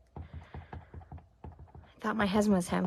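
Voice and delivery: winded, whispered apology; whispering while catching breath; breathless whisper. Real, not acted. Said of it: "out of breath from running".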